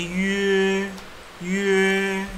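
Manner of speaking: "ye" starting from pursed lips, long and high; rounded üe sound. Shown as a demonstration. Teacher reading dotted U with E, long rounded "ye". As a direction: glide from ü into a level drawn-out "ye". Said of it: "Jadi dia bacanya ye".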